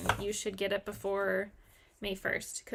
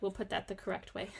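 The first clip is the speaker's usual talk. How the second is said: ordinary talk, nothing marked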